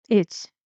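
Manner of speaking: "It" dropped short; a brief falling "It"; falling intonation. Shown as a demonstration. Teacher isolates the last word, falling "It". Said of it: "Falling intonation".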